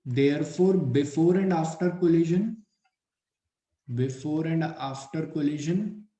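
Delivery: slow, measured classroom dictation; word by word, with pauses to write. Acted. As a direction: dictate in short phrases for note-taking. Dictates points for students to write.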